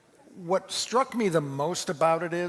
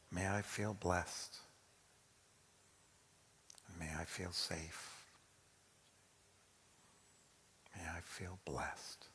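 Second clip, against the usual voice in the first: hushed, slow blessing; soft prayerful repetition; 8 semitones lower, 16 dB quieter, slower than usual. Shown as a demonstration. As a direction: say it softly like a prayer. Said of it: "just repeat them to yourself".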